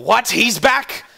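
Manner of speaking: loud, high-pitched incredulous outburst; overheard shouting. Acted. Game master voicing guards hearing who is back, shouted. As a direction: shout it like startling news. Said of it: "And you hear shouting".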